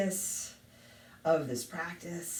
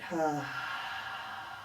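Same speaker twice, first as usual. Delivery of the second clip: long, soft open sigh out; long exhale; 3 semitones lower, 7 dB quieter than usual. Shown as a demonstration. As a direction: breathe out audibly on a slow "ha". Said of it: "And a long breath out".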